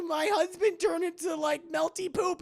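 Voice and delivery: very high, loud put-on wail; imitated upset wife; mock distraught woman. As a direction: whine it in hammed-up distress. Acting distressed widow.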